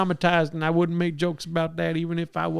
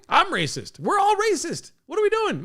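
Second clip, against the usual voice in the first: mock mom; 13 semitones higher than usual. Mocking mom's outraged reply.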